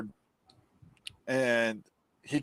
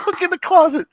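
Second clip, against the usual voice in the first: high, loud jeer at the screen; hammed-up scolding of the character; mocking shout; 9 dB louder than usual. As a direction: yell advice at a dumb horror heroine. Mocking the character's bad choice.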